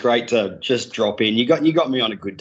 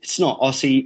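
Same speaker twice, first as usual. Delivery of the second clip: usual speaking voice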